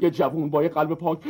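ordinary delivery

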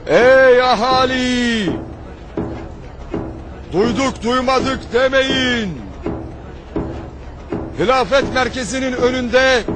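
town crier. Acted. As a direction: call it out across the square. Town crier's public announcement.

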